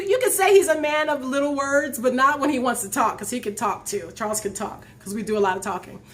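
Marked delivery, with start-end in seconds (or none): none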